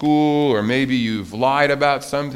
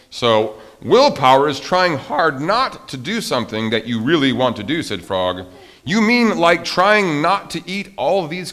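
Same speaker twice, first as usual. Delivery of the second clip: loud, animated read-aloud with big pitch swings; story reading; 7 dB louder than usual. A narrator's reading. Teacher reads Frog and Toad to children, animated storytelling.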